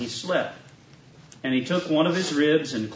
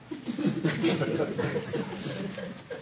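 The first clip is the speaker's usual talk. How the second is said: soft breathy laugh; light laugh; 13 semitones higher, 8 dB quieter than usual. Real, not acted. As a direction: laugh quietly at the joke. Laughs at own naked joke.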